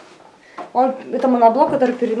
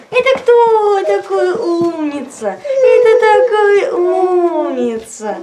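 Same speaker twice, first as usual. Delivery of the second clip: squeaky praising a dog; 4 semitones higher, 4 dB louder, much slower than usual. Real, not acted. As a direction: praise the dog sweetly, stretched out.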